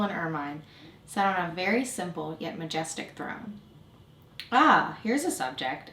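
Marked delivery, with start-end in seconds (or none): 4.5-5.9 drawn-out, swooping exclamation; exclaiming king. A narrator's reading. King spots his visitor, exclaiming slowly. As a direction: exclaim it slowly, pitch lifted. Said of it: "exclaimed the king".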